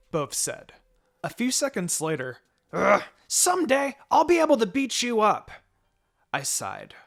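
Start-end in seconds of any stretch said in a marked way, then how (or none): 2.7-5.5 put-on high, loud childish whine; grumbling boy. A narrator's reading. Boy grumbling at his rival, high loud whine. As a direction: whine it like a sulky kid.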